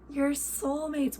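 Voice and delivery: gasp, then high and slow; thrilled, drawn-out delight; excited gasp. Real, not acted. Excited about fan match.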